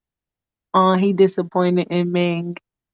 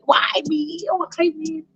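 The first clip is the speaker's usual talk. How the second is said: high, quieter mock lament; distressed Michael; 7 semitones higher, 4 dB quieter, slower than usual. Michael overwhelmed by her advances, high mock lament. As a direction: wail it lightly, up high. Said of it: "And Michael is like".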